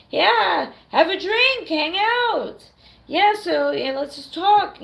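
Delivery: high, cheery welcoming voice; friendly Brit host. Retold or imitated. British partygoers welcoming a stranger, high and cheery. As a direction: invite a stranger in, upbeat and easy. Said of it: "they'll just be like".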